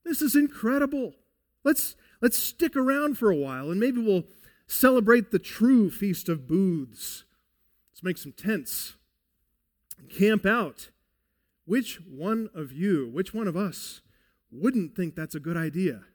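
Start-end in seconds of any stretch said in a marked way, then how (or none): 0.0-10.9 excited Peter. Acted. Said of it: "He's saying here".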